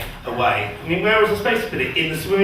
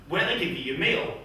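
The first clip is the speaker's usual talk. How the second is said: ordinary talk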